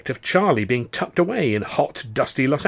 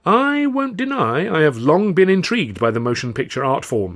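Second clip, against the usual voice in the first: pantomimed thoughtfulness; 6 semitones higher, 3 dB louder than usual. A narrator's reading. Comedian feigning consideration of a film offer, thoughtful. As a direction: make a show of considering it. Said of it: "pantomiming thoughtful consideration".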